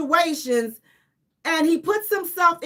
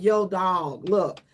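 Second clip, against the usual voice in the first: lower, laid-back buddy voice; good friend pulling Tyrese aside, low and chill; friend telling him off; 7 semitones lower than usual. Retold or imitated. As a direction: talk him down like a homeboy. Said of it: "be like".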